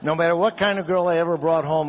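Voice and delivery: normal talking voice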